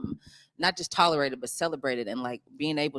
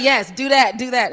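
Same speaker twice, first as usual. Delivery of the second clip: high, loud, urgent encouragement; retold director's approval; 9 semitones higher, 9 dB louder than usual. Actress quotes director approving her reading, excited. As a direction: cheer the actor on, retelling it. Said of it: "He's like".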